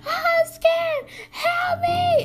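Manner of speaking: high, drawn cry for help; mock scared wail. Storyteller mimics friend left again, mock scared wail.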